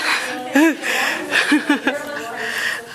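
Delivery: short breathy nervous laugh; laughing off the nerves. Real, not acted. Nervous laugh mid-presentation.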